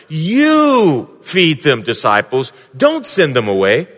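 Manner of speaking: "You" stretched out slow and heavy; stress on you. Shown as a demonstration. Jesus's command voiced by preacher, slow emphatic "You". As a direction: drag out "You feed them" for weight. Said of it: "in bold caps underlined".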